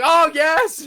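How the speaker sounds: hammed-up shriek of triumph; ecstatic cheer. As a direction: celebrate the machine finally starting. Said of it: "I'm like".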